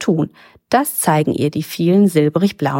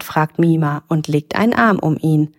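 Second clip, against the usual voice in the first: ordinary delivery, nothing marked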